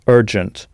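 "Urgent" with English er then jent; English pronunciation. Shown as a demonstration. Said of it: "notez bien la prononciation".

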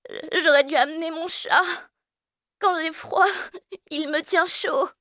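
very high plaintive voice; shivering child. Acted. Aglaé shivering, wishing for her cat. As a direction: whimper from the cold. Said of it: "Aglaé grelotte".

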